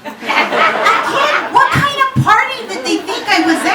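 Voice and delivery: loud, high-pitched comic outrage; indignant outburst. Acted. Comedian reacting to her sister's question, indignant. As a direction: exclaim it high and loud in disbelief.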